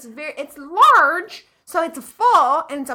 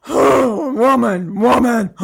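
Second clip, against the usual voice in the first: leering Ferengi voice; 6 dB louder, much slower than usual. Retold or imitated. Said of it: "You'd be like".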